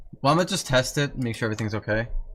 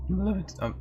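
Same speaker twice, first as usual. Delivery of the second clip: voice crack; 10 semitones higher than usual. Real, not acted. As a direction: voice cracking up high on limit-. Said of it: "that was a bad voice crack".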